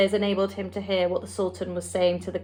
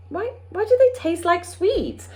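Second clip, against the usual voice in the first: higher, slow bewildered king; baffled, drawn-out question; King of Sweden asking why; puzzled king; 10 semitones higher than usual. A narrator's reading.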